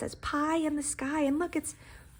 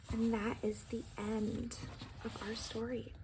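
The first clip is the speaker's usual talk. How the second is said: soft story ending; 10 dB quieter than usual. Acted. Story ending read softly.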